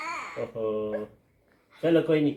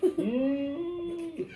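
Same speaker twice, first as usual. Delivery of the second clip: cow moo; much slower than usual. Real, not acted. Child answers mother's question with a long moo. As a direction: hold a stretched moo like a little calf. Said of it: "ये काऊ में पूरी जान है।".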